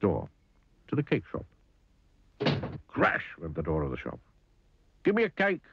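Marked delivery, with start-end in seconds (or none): 5.0-5.6 high-pitched, grumpy order; snapped demand. A narrator's reading. Mister Grumpy to the frightened butcher, snapping. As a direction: snap it rudely at a shopkeeper, pitched up. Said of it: "snapped Mister Grumpy".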